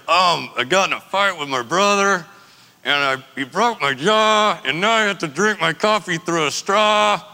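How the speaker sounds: mild imitation of talking through clenched teeth; jaw wired shut. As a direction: mumble with the jaw locked. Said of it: "came in with his jaw wired shut".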